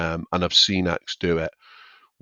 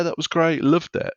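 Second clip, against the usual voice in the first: much higher, bubbly post-show gush; thrilled after the gig; 10 semitones higher than usual. Retold or imitated. Comic coming off stage, to fellow acts: much higher thrilled gush. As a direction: gush like it all went perfectly. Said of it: "they come off and they're like".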